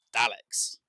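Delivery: Daleks drawn out loud, short A as in cat; corrected pronunciation. Shown as a demonstration. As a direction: hammer out Daleks slowly with no R. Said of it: "the pronunciation is wrong".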